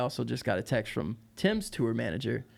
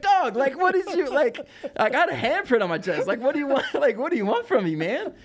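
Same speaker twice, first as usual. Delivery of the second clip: laughing disbelief; 11 semitones higher, 5 dB louder than usual. Retold or imitated. Retold disbelief after stiff arm.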